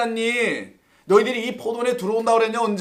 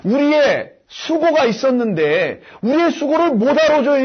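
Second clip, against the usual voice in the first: complaining workers; 5 semitones higher, 6 dB louder than usual. Retold or imitated. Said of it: "이런 얘기하는 거잖아요".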